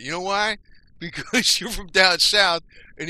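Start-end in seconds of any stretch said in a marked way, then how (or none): 1.0-2.6 louder, laughing through the words. Real, not acted. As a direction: laugh it out at the southerners. Teasing Southerners, laughing.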